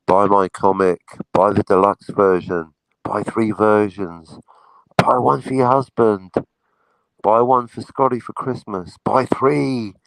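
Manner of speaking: slow, drawn-out subliminal command; slow mock hypnotist coaxing a sleeping fan; hypnotic sleep suggestion. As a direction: lull her into buying, slowly and steadily.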